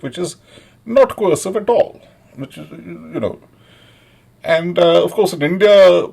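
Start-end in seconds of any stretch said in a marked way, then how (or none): none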